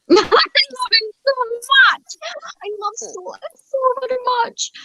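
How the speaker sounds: very high, drawn-out fangirl squeal; gushing adoration. Real, not acted. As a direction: gush slowly, pitched right up with adoration. Gushing over favourite love interest.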